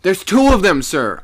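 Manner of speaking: loud, high urgent call. A narrator's reading. Sailor warning the skipper above him, calling out. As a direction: call it out, dropping on the last word. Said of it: "I called again to him".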